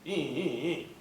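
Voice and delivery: short repeated engine-cranking squeals; imitated motor cranking. Boater turns the key on a dead motor, imitated cranking. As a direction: voice a starter motor straining. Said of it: "Won't start".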